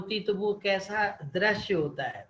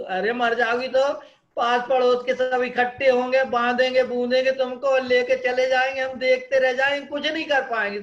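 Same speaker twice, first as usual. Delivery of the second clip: louder exasperated telling-off; loud scolding husband; 7 dB louder than usual. Acted. Husband loudly scolding wife for talk of death. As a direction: scold her loudly about dying.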